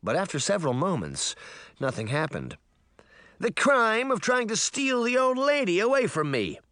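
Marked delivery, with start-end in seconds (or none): 3.4-6.6 high, put-on accusing boy voice; theatrical high-pitched accusation; boy's declaration. A narrator's reading. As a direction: declare the charge like a child prosecutor. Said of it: "the mop-topped boy declared".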